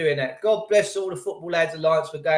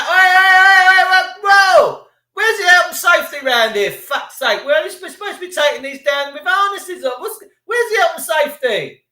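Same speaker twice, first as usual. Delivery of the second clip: much higher and louder, yelling crowd of blokes on a statue; rowdy workers shouting; 12 semitones higher, 10 dB louder than usual. Retold or imitated. Imitating rowdy workmen shouting about health and safety. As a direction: bellow it like workmen on a rooftop.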